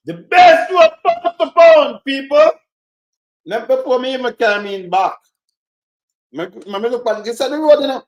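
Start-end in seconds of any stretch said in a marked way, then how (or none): 0.1-2.5 slow, loud sneering repeat of the slogan; mocking slogan. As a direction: ham up the boast, drawn out.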